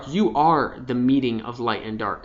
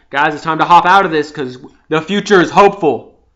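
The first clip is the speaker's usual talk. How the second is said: louder, rising call to move on; rousing; 3 semitones higher, 9 dB louder than usual. Real, not acted. Urging viewers out of their comfort zone, loud hopeful call. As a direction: lift them up and out. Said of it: "urging out of comfort zone".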